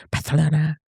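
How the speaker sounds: "Barcelona" softly, th for the c; classmates' lisped pronunciation. Retold or imitated. Friend remembering the classmates, "Barcelona" softly with their lisp. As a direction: say "Barcelona" softly as Bar-the-lona. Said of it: "the way they pronounced".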